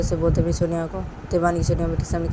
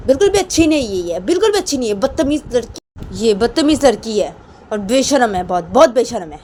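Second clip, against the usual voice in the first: outraged scolding; 5 semitones higher, 6 dB louder than usual. Acted. Elder calling Rama a rude shameless girl, indignant rebuke. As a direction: condemn her indignantly.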